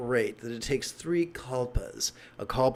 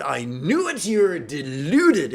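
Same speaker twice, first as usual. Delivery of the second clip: mock indignant shout; 3 semitones higher, 8 dB louder, slower than usual. Skeptic triumphantly accuses, stomps off.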